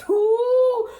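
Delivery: sudden high, loud "ooh"; excited squeal. Real, not acted. Excited reaction to her own threat.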